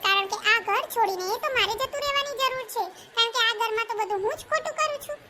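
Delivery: normal talking voice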